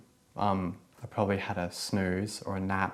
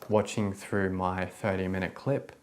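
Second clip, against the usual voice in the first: ordinary delivery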